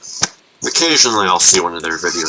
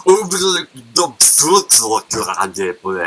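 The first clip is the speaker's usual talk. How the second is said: mock Welsh town name. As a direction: garble a long, unpronounceable Welsh name. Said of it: "have you seen the names of their towns".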